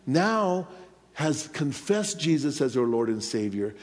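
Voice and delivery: ordinary talk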